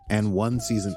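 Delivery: ordinary talk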